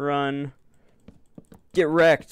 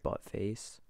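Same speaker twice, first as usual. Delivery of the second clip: whispered insult; 3 semitones lower, 11 dB quieter than usual. Real, not acted. Insulting a fallen orc.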